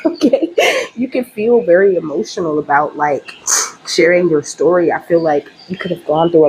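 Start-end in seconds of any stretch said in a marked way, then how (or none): 0.0-0.5 laughing okay. Real, not acted. Laughing after playful aside.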